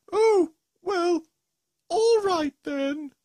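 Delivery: high, slow, sulky monster; Gruffalo voice. Acted. Gruffalo gives in.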